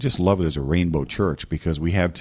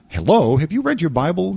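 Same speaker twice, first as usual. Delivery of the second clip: exasperated retort; 10 semitones higher, 4 dB louder than usual. Retold or imitated. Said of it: "I'm thinking".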